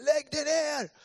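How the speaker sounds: high, loud command to get down; shouted police order. Retold or imitated. Nervous policeman to him at gunpoint, shouting. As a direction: yell it at an armed suspect.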